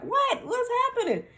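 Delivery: very high-pitched mock confusion; imitating alarmed mom. Mom who didn't know what was going on, high mock alarm. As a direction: squeak it like a startled mom. Mom baffled by her freaking out.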